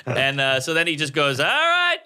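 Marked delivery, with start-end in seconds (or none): 1.4-2.0 mock cheerful host. Host brightly resumes after the weeping.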